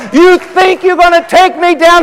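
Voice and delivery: high-pitched preacher's challenge; defiant taunt. Acted. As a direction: throw it in the devil's face. Taunting the devil while preaching.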